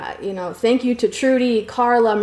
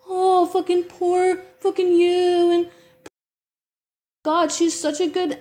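slow, higher put-on voice sneering at the pity; mocking pity; 6 semitones higher than usual. Parodying people who pitied the woman she called out, slow sneering mockery. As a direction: sarcastically parrot the sympathy she got. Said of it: "those people still thought it was about".

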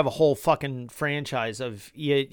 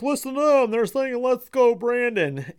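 higher-pitched mock reporter voice; imitating reporter; 8 semitones higher than usual. Vlogger mimics the reporter covering the chant, higher mock voice. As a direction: play the reporter pointing at the crowd. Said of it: "a reporter was like".

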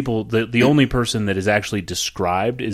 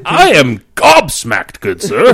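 slow, high, loud pompous voice; mock posh gentleman; 7 semitones higher, 9 dB louder than usual. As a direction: declare it like an old-fashioned gentleman. Joking round of fancy synonyms.

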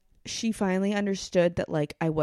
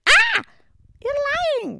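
high, loud outburst imitating an angry grandmother; hammed-up furious accusation; mad grandmother; 8 dB louder than usual. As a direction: shriek it in mock outrage, much higher and louder. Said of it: "when she was that mad".

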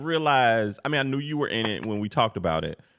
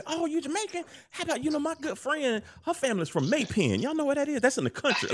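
high, soft know-it-all chatter; name-drop in a high, eager voice; acting like an insider; 4 dB quieter than usual. Bluffing to a Jamaican about local connections, acting like an insider. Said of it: "I be talking like I, like I know".

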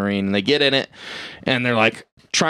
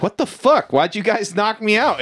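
much higher, indignant surprise; a high, outraged wake-up cry; startled waking outburst; 10 semitones higher than usual. Retold or imitated. Harry waking and demanding why they drugged him, high and indignant.

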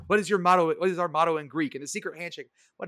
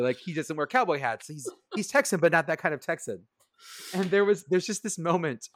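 ordinary delivery

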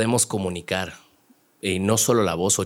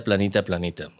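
usual speaking voice

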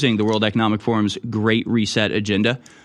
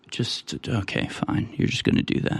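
much softer, near-whispered resignation; too tired to fight; 6 dB quieter than usual. Retold or imitated. Worn-out person letting an annoyance slide, faint sigh. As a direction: give up with no energy left. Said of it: "You're just like".

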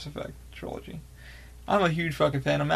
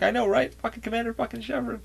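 retold excitement; 8 semitones higher than usual. Friend shares fan excitement.

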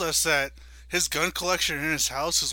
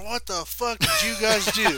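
higher-pitched, worked-up neighbor impression; neighbor John; 5 semitones higher than usual. Neighbor pounding on the door after the outage, worked up. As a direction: act out an outraged neighbor at the door. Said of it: "I don't know why I did that accent".

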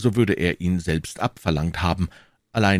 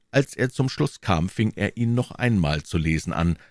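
plain reading voice

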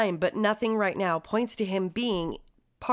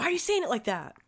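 higher-pitched, defensive mock alarm; mock panic; 5 semitones higher, faster than usual. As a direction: play flustered at being accused. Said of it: "because you've now scared me".

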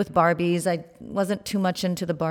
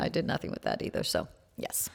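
whispered aside; 12 semitones lower, 9 dB quieter than usual. Real, not acted. Self-deprecating aside after fashion story.

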